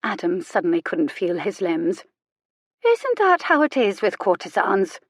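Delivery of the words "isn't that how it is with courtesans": higher, wondering question; understated sad curiosity; curious, saddened. Acted. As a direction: ask with genuine curiosity, a touch saddened. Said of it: "sounded genuinely curious, if saddened".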